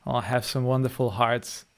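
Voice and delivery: ordinary talk, nothing marked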